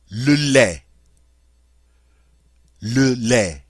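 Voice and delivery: "lait" as leh, with the T silent; French pronunciation. Shown as a demonstration. Teacher modelling "Le lait" for the class. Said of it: "the word for milk".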